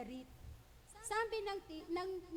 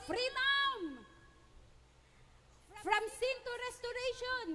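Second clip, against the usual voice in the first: high, loud shout of freedom; mock Braveheart yell, high and loud; Braveheart battle cry; 7 semitones higher, 3 dB louder, slower than usual. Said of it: "talagang sumigaw siya".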